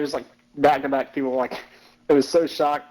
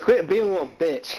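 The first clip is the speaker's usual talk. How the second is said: laughing taunt; 6 semitones higher than usual. Retold or imitated. Taunting friend, laughing through it.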